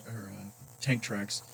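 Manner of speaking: ordinary delivery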